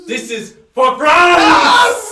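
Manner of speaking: loud, slow high yell; battle cry. Acted. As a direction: scream it as you charge.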